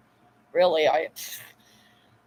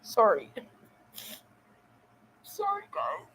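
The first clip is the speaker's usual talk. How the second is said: choked, high, faint tearful voice; crying; 4 semitones higher, 10 dB quieter than usual. Real, not acted. Streamer apologizing to viewers for being emotional, crying. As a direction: squeeze the words out through real tears. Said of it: "I'm such an emotional person".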